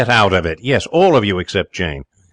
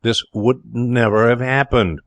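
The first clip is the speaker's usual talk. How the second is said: slow, severe reproof; 3 semitones higher than usual. A narrator's reading. Jerry blaming Pa Tuxton, severe and deliberate. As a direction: drive the blame home slowly. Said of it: "severe to Pa Tuxton".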